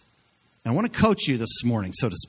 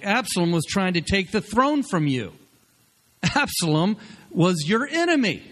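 Joab's rebuke; 6 semitones higher than usual. Retold or imitated. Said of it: "He's like".

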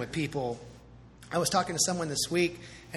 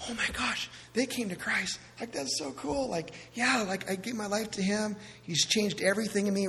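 higher, giddy new believer; imagined friend gushing that someone came to Christ, higher; excited gushing; 5 semitones higher, 3 dB quieter than usual. Retold or imitated. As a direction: gush about the conversion.

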